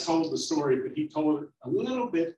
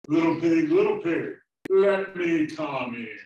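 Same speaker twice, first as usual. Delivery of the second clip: big bad wolf; 4 semitones higher, 8 dB louder than usual. Wolf at the pig's door, loud demanding call. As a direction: boom it out like a storybook wolf. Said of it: "and he said".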